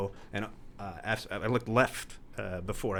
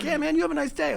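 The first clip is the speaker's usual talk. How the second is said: laid-back stoner; 4 dB louder, faster than usual. Retold or imitated. Stoner farewell.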